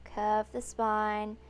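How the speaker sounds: slow cues with long-held, emphatic inhale and exhale; yoga breath cues. Real, not acted. Yoga teacher counting the class through breaths by cue, slow and drawn-out. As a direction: draw out each cue to pace the breathing.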